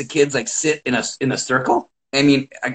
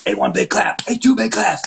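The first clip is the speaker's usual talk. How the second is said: higher and higher rallying calls; building hype; 8 semitones higher than usual. Shown as a demonstration. Coach hyping the kids for a clap. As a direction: pump the room up. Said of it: "I give him the old".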